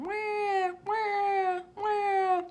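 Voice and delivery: put-on high, far baby cry; baby wailing. A narrator's reading. Narrator imitating the baby brother wailing. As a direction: wail like a newborn.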